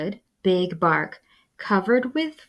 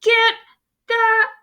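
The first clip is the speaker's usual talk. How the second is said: rising to a high, louder shout; battle cry; 13 semitones higher, 4 dB louder than usual. Acted. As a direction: yell them into the fight.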